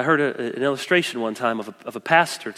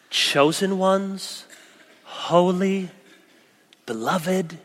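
slow scripture reading; 4 semitones higher, 3 dB quieter, much slower than usual. Acted. Reading scripture words slowly.

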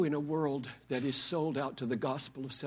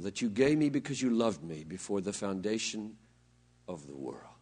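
hushed reverent Bible reading; scripture reading; 9 semitones lower than usual. Acted. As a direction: read it reverently, letting it settle. Reading Jesus' prayer from scripture.

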